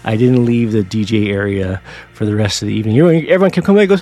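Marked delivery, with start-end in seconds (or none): none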